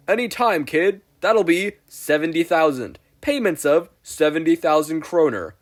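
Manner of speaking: high, loud huckster pitch; glitchy pitchman announcing himself; Spamton salesman voice. Acted.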